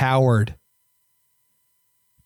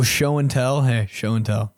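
ordinary delivery

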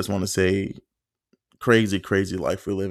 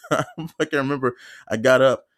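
ordinary talk

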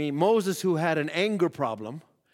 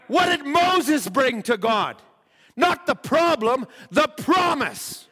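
slow, higher, louder exhortation; full-force preaching; 7 semitones higher, 4 dB louder, slower than usual. Acted. Preacher urging the congregation, slow and forceful. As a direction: drive the point home to the congregation.

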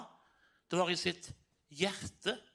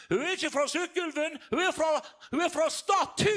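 high, loud call from the crowd; audience shouting; 9 semitones higher, 4 dB louder than usual. Retold or imitated. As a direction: shout it out like people in the hall. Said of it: "da ropte de fra salen".

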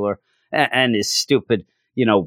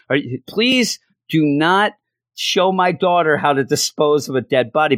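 higher, pleading exasperated dad; 6 semitones higher than usual. Retold or imitated. [name] begging his wife's partner to stop, exasperated higher voice. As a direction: beg him to stop, half laughing in disbelief.

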